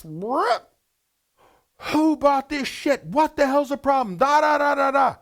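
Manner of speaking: high, loud, furious mock rant; angry owner outburst. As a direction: blow up at the kitchen staff in a high yell. Owner finds cheap steaks.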